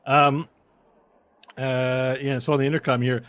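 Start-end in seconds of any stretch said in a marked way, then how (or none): none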